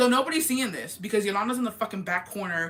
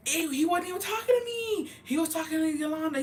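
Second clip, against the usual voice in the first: much higher, scoffing dismissal; disgusted retort; 9 semitones higher than usual. Retold or imitated. To the jealous boyfriend, a high scoffing denial. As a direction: toss it off high with an "ew".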